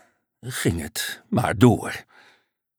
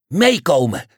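higher, louder brisk command; calling out an order; 7 semitones higher, 5 dB louder than usual. A narrator's reading. Unicorn summoning the frog and duck, commanding call. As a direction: call them along lightly but firmly.

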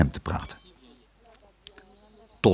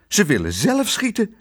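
high-pitched alarm; alarmed, pitch jumping up; startled fright; 10 semitones higher than usual. A narrator's reading. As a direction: report it in shock. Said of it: "zei Tos verschrikt".

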